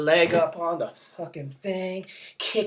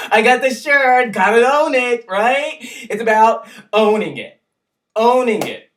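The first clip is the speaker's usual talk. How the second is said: loud, high and laughing; excited about the shirt; 5 semitones higher, 7 dB louder than usual. Real, not acted. Vlogger bragging about his new shirt, giddy and loud. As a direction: show off the shirt, thrilled.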